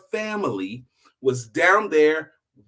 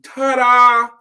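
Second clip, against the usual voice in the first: high, loud, stretched-out ta-da; slow showy ta-da; 7 semitones higher, 10 dB louder than usual. Retold or imitated. Preacher voicing the girl's reveal atop the tower, slow and loud. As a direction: draw it out like a big reveal. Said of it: "throws her arms up".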